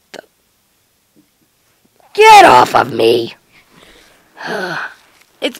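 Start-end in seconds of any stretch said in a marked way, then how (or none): 2.1-3.3 monster voice. Acted. Kyuubi voice low.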